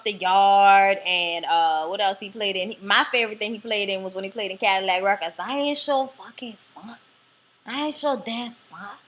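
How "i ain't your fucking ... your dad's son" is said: slow movie-line impression in a New Orleans accent; put-on New Orleans drawl; imitated New Orleans accent. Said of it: "he tried to do our accent".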